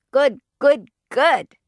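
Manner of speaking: put-on high, loud toddler chirp; little boy. A narrator's reading. Fudge echoing his mother, emphatic little-boy voice. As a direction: punch each word out like a small child.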